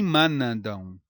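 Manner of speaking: "manandam" com N de consoante, mâ-na-dão; pronúncia errada. Shown as a demonstration. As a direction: solte o N de "manandam" numa sílaba lenta. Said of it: "nem manandam". ("manandam" como mandam com "nâ" no meio)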